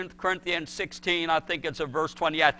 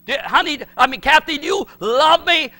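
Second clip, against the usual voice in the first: much higher, much louder plea; loud, high-pitched plea; asking his fiancée; 9 semitones higher, 8 dB louder than usual. Retold or imitated. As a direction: call out to her, eager for an answer. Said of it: "retold question to fiancée".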